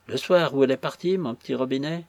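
plain reading voice

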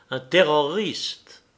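"terroriste" slowly, syllable by syllable; syllables scanned. A narrator's reading. Woman naming him "terroriste", slowly syllable by syllable. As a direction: measure out "terroriste" firmly, each syllable separate. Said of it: "en scandant les syllabes".

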